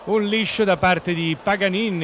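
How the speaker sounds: excited commentary. Real, not acted. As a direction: jump in as the play breaks. Live commentary, sudden action.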